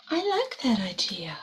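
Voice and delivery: whispered aside. Real, not acted. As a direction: hush it like a secret.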